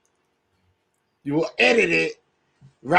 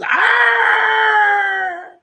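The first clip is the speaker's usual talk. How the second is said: high shrill-free yelp, hammed up; a sudden put-on cry; mock shriek; 14 semitones higher, 4 dB louder than usual. Acting out Karen's outburst.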